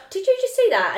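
high, loud incredulous quote; shocked disbelief. Retold or imitated. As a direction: blurt it out in disbelief. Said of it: "I was like".

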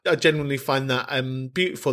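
ordinary talk, nothing marked

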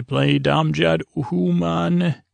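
lightly put-on alien voice, drawn out; alien taunt. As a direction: imitate a slow alien challenger. Voicing alien challengers in retelling.

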